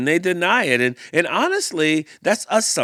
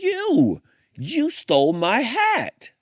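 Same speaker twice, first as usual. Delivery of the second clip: much higher, slow, outraged accusation; accusing bear; 10 semitones higher, slower than usual. Acted. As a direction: point the finger and draw it out.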